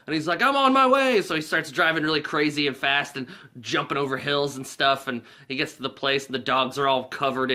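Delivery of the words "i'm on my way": high, loud mock yell; imitated hurried reply. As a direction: yell it back into the phone. Driver shouting back to caller.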